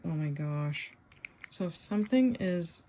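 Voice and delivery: ordinary talk, nothing marked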